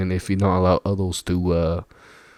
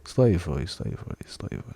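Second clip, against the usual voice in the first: fading repetition; 4 semitones lower, 6 dB quieter than usual. Acted. Repeating word fading away.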